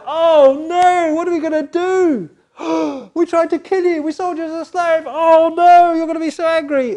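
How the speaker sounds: very high, loud panicked wailing with gasps; terrified brothers. Acted. Brothers terrified before the brother they sold, shrieking in panic. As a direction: shriek in terror at what they did. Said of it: "the brothers were terrified".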